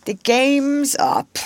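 high, slow, drawn-out outburst; passionate cry. A narrator's reading. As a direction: lament it as a captain in despair. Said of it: "he spoke passionately".

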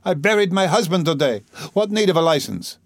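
mildly wailing indignation; distressed protest. Acted. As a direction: protest like an affronted widow. Acted distressed protest to policeman.

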